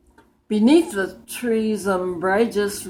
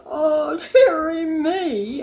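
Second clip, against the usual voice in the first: high, louder, slow hammed-up sob of "dearie me"; king sobbing; 8 semitones higher, 5 dB louder, slower than usual. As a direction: wail it like a sulky king in tears. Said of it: "The king sobbed".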